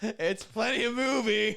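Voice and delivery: slow, amused mock echo of plenty of movie; a teasing echo of a friend's line; mocking repeat. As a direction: repeat it back slowly with a laugh. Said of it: "mocking repeat of friend".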